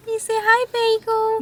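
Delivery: very high sweet puppy talk. Real, not acted. Talking to new puppy.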